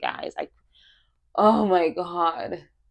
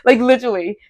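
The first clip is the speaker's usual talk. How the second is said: higher, fired-up self-pep; pumped-up self-talk; 5 semitones higher, 5 dB louder, faster than usual. Retold or imitated. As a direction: talk yourself up, pumped and defiant. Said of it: "I'm like".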